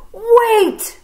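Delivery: high loud call; urgent call. Acted. Snake stopping the friends, calling out loudly. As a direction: cry out to stop them.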